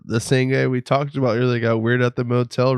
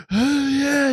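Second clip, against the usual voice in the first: high, drawn-out mock "oh, yeah"; hammed-up excited onlookers; ogling club dudes; 13 semitones higher than usual. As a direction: imitate guys cheering on a dancer. Voices leering dudes at the club.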